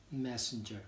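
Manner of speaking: normal talking voice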